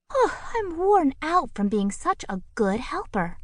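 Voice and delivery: high, sleepy, self-satisfied bird; yawning bird. A narrator's reading. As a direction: sigh it out, pleased and tired.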